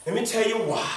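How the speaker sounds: ordinary talk, nothing marked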